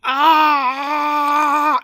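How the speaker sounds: loud high scream; short shrieking animatronic yell; mock animatronic scream. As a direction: shriek like a robot bunny. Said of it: "the bunny's just like".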